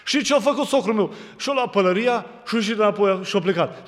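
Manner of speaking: high, loud revival preaching; fiery preaching. Acted. Preacher lecturing the congregation about imagined illness, full force. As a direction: preach it out to the crowd.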